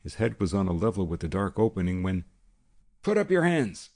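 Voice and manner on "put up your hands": high, loud barked command; sudden shout. A narrator's reading. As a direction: shout it out from the dark. Gunman surprises guard from loft.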